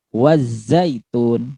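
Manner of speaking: very slow "wazzaitun", z drawn out. Shown as a demonstration. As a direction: stretch the z of "wazzaitun". ("wazzaitun" pelan, z dipanjangkan)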